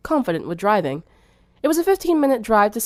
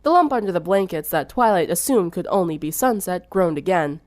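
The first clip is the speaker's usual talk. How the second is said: usual speaking voice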